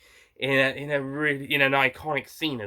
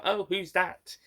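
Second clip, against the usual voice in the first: lightly acted puzzled question, pitched higher; curious wondering; 7 semitones higher, slower than usual. Imagining characters spotting a car, mildly curious.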